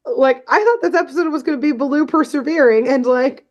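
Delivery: high, quick, baffled protest; incredulous protest. Retold or imitated. Podcaster objecting to the robot's win, incredulous. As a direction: protest the twist in rushed disbelief. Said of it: "I was like".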